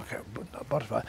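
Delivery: quick, low, offhand dismissal; blasé. Acted. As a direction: toss it off softly, barely interested. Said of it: "we get sort of blasé".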